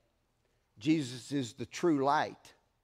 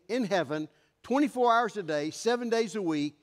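ordinary delivery

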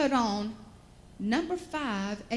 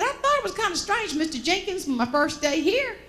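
higher, lightly voiced humble farm worker explaining; a modest hired man telling the boss how he got his nickname, higher; worker's explanation; 7 semitones higher, 4 dB louder than usual.

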